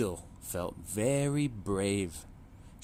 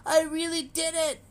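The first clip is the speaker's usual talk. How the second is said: very high joyful cry; the littlest duck proud at last; triumphant duckling; 6 dB louder than usual. A narrator's reading. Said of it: "he cried".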